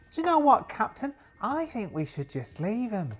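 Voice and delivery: very high-pitched pleading voice; high put-on timid sidekick; nervous crewmate. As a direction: plead with your captain, pitched right up.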